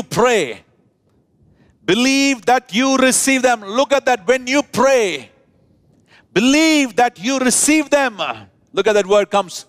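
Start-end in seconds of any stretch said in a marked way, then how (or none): 1.9-8.2 preaching at full force, higher; rising preaching. Real, not acted. Preacher urging his congregation, rising preaching. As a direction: urge the church to believe, climbing.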